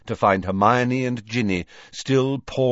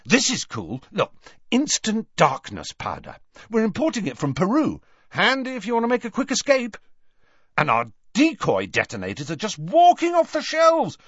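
high, eager product demonstration; twin salesman, enthusiastic; 12 semitones higher than usual. A narrator's reading. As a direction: show off the wares with rising zest.

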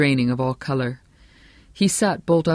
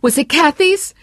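high, loud shriek of dread; screamed question; 13 semitones higher, 8 dB louder than usual. A narrator's reading. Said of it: "Shelley Chatsworth screamed".

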